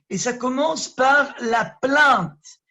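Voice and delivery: slow, loud and high, driving the point home; forceful preacher's slow proclamation; emphatic declaration. Real, not acted. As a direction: proclaim it slowly, hammering each word. Preacher emphasising first kind.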